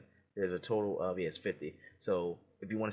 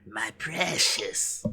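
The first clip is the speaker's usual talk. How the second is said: 1 Gollum voice; much slower than usual. Acted. Quoting Lord of the Rings.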